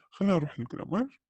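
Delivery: ordinary delivery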